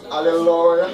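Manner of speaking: imitating a hoarse, drawn-out loud hallelujah; broken voice. Preacher showing how evangelists say hallelujah, loud broken voice. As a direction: bellow it like a worn-out prayer leader. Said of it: "ils ont des voix cassées".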